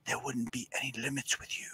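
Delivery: hushed breathy whisper. Acted. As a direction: whisper it right into an ear. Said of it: "You're disappearing when you said that".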